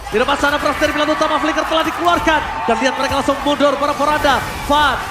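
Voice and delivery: loud hype play-by-play call; excited shouting. Real, not acted. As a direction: shout the fight as it happens.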